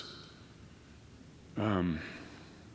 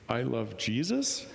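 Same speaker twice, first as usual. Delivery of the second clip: slow, giggling I love Jesus; laughing disbelief; 3 semitones higher, slower than usual. Retold or imitated. To the lady in bed, amused slow blurt. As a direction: say it half laughing at yourself.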